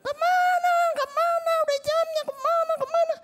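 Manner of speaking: high, loud angry yelling at the driver; angry scolding. Retold or imitated. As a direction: shout it like a whole bus fed up. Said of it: "di ngomelin satu bus".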